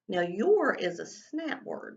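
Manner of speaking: usual speaking voice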